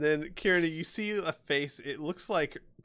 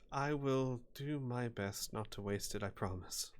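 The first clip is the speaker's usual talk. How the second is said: sighing promise; 9 dB quieter than usual. Acted. Claire accepting the bolt from her companion, soft sighing promise. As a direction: say it softly after a sigh, giving in. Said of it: "she sighs and she goes".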